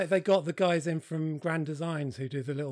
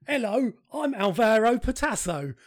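put-on English accent dub; 5 semitones higher, 6 dB louder than usual. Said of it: "English accent".

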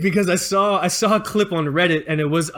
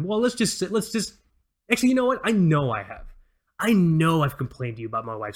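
normal talking voice

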